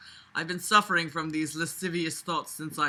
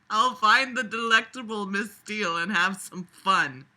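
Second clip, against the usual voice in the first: ordinary talk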